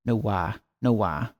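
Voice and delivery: "No wah" with way said as wah, low and soft; musing on an accent that says "No wah"; accent test. Shown as a demonstration. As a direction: try "No wah", open ah vowel for way. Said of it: "uses the vowel for way as, like, an ah".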